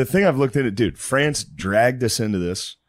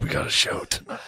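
mock resigned whisper; 4 dB quieter than usual. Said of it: "And just like".

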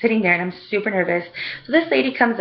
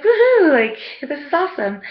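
delighted cheer; 8 semitones higher than usual. Retold or imitated. Vlogger recalls loving how little was cut, delighted cheer. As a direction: let out a high woohoo of delight. Retells own delight at haircut.

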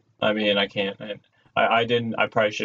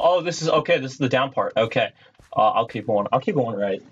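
normal talking voice

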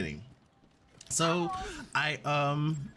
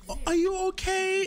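very high imitation of a worried onlooker; concerned bystander. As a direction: mimic someone fussing, pitch way up.

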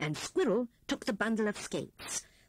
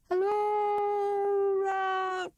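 much higher, louder sly owl call; owl greeting; 11 semitones higher, 5 dB louder, much slower than usual. A narrator's reading. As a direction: hoot the greeting from above like an owl. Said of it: "said he".

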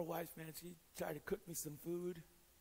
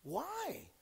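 mock puzzlement; 11 semitones higher, 4 dB louder than usual. Real, not acted.

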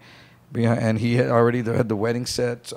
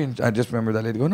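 ordinary talk, nothing marked

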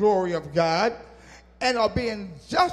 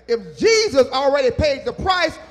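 loud, high, rousing preacher's climb; preaching at full force; 6 semitones higher, 8 dB louder than usual. Acted. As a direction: lift the congregation, louder and higher.

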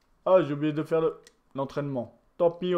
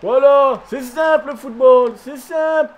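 much higher and louder, crowing with delight; triumphant shout; 8 semitones higher, 9 dB louder than usual. Real, not acted. Gamer gloating to viewers after scoring, triumphant shout. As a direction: yell it like you just scored the easiest goal. Celebrating his goal in game.

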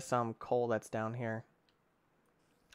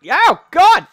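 loud, high panicked cry; alarmed scream; 20 dB louder than usual. Real, not acted. Player attacked by the creature in game, alarmed scream. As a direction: scream out under sudden attack.

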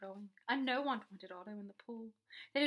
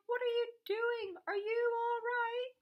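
high, slow, sustained call; long-drawn storybook shout; yelling; 12 semitones higher, 6 dB louder than usual. A narrator's reading. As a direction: holler anxious questions over a distance. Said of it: "I yelled".

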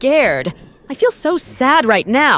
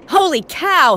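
ordinary talk

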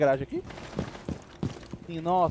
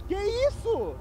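stunned disbelief; 8 semitones higher, much slower than usual. Real, not acted. Stunned by the instant defuse.

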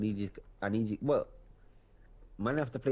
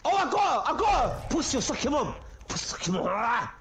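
hyped shouting; 12 semitones higher than usual. Real, not acted. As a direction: scream it like you just got the kill. Getting a kill, hyped.

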